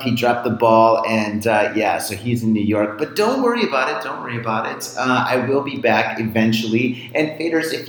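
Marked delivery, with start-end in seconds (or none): 3.0-4.0 high, fast and bright, brushing it off; sing-song don't-worry brush-off; breezy reassurance. Acted. Reassuring listeners while stuck abroad. 4.0-4.9 quiet, sincere follow-up; soft reassurance. Acted. Repeats reassurance right after.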